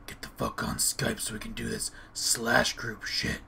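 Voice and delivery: usual speaking voice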